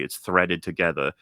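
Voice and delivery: ordinary talk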